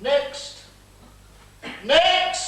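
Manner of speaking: calling out. Acted. General calling petitioners.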